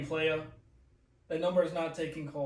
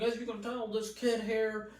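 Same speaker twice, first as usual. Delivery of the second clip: higher-pitched, patronising imitation; condescending guest; 6 semitones higher than usual. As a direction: talk down about the host to the audience. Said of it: "condescending right from the start".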